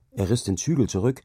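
ordinary delivery

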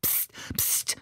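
soft, voiceless warning hiss; whispered hushing hiss; hissed shush. A narrator's reading. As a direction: shush them sharply under your breath. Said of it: "zischte Ali".

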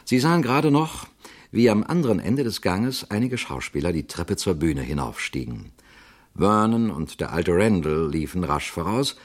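ordinary delivery, nothing marked

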